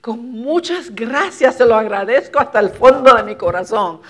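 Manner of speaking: effusive Puerto Rican Spanish thanks, fast and loud; lively Puerto Rican Spanish. Retold or imitated. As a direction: pour out the thanks in quick island Spanish. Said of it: "They say".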